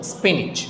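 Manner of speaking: স্পিনিচ with a soft j-like ending; correct pronunciation. Shown as a demonstration. Teacher names the final consonant, correct pronunciation of স্পিনিচ. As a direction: close স্পিনিচ on "ij", falling. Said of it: "বর্গীয় জ".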